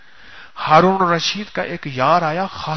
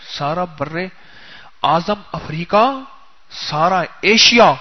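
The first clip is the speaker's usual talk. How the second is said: normal talking voice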